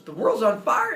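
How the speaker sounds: loud, high cry of alarm; acted shocked yell; alarmed outburst. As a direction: blurt it out in hammed-up panic. Zeus reacts with alarm to news.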